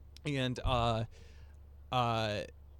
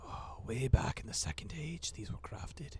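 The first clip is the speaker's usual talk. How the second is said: whispered, awed dwarf lore; a dwarf murmuring of the Second Age; hushed dwarf; 2 semitones higher than usual. Acted. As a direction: whisper the old history like a secret. Dwarf recounting ancient origin.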